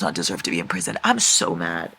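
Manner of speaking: low, fast sulky grumble; sulking reader. Real, not acted.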